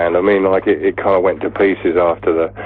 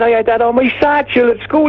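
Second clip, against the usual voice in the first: high-pitched mock Welshman; Welsh accent. Welsh fan tells the band about schooldays, Welsh accent. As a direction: reminisce in a very high Welsh caricature. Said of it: "when we play in Wales".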